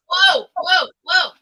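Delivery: loud surprised whoa; startled whoa. Real, not acted. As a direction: caught off guard. Cat jumping.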